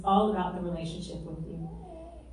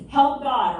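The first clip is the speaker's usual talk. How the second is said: mock cry for help; 6 semitones higher, 9 dB louder than usual. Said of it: "So the first one is".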